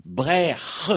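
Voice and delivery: "Brèche" said brekh, rasping the ending; "Brèche" closed in the throat, no sh; Breton C'H sound. Shown as a demonstration. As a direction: say "Brèche" with a long guttural kh. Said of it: "comme si on écrivait C apostrophe H".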